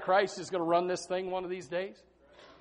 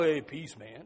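hippie voice; 7 semitones lower, 5 dB quieter, slower than usual. Retold or imitated. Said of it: "the hippie peace of years ago".

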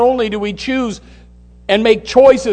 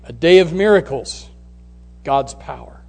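ordinary talk, nothing marked

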